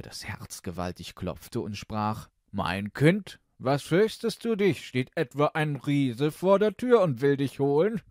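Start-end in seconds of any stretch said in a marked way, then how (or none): 2.5-8.0 loud, high, jovial king voice; amused king. Acted. King teases his frightened daughter, loud and jovial. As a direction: boom it out as a teasing royal father.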